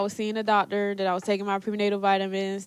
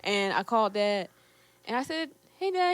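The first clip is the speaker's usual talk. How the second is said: ordinary talk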